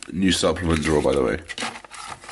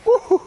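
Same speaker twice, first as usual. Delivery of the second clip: very high, loud woohoo; excited whoop; 6 dB louder than usual. Real, not acted. Vlogger out in the wet weather, high excited whoop. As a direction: let out a high two-note whoop.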